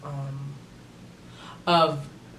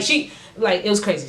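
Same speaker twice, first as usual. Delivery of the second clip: usual speaking voice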